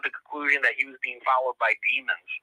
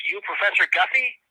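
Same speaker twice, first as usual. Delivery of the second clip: put-on Southern accent; 7 semitones higher than usual. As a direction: imitate a Southern caller, pitched up. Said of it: "guy with a thick Southern accent".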